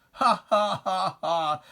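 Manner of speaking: a hammed-up villain's laugh, read not laughed; jeering recited laugh; mock gloating laugh.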